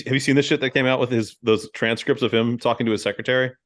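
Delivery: ordinary delivery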